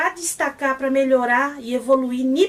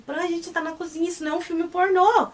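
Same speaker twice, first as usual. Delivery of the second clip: higher, half-scandalized voiced line; playful protest; 6 semitones higher than usual. Pet protests to Pran about the kitchen, mock scandalized. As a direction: tease him in mock shock.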